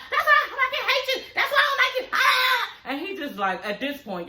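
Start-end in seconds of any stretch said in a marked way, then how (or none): 0.1-2.7 high-pitched shrieking imitation of a girlfriend; mock yelling. Vlogger mimicking a woman screaming at her ex on the phone, mock shriek. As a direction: screech it like she's losing it. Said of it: "This bitch getting louder and louder".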